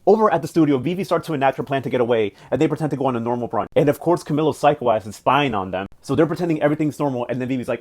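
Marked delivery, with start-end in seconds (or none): none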